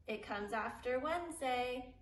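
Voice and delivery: ordinary talk, nothing marked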